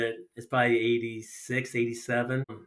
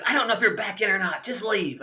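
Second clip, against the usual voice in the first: retold yelling; 7 semitones higher than usual. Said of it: "he yells at me for probably fifteen minutes".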